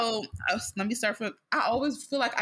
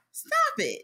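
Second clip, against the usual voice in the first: mock high protest. Retold reply to dad.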